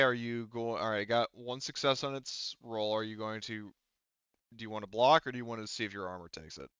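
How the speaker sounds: ordinary talk, nothing marked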